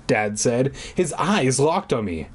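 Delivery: ordinary delivery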